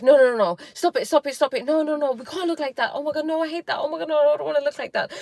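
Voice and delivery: frantic no no no, pleading stop it; panicked self-talk. Retold or imitated. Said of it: "And I'm like".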